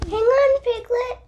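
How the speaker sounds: high, loud call to Piglet; child's excited Pooh call. A narrator's reading. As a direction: shout up into the wind. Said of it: "Pooh called".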